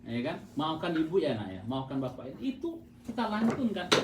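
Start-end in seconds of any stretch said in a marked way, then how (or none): 0.0-2.3 tender apology. Acted. Voicing parent's bedtime apology.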